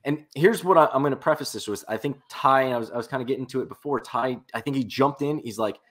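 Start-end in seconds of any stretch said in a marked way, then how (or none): none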